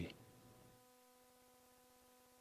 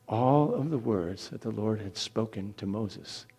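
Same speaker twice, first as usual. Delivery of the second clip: usual speaking voice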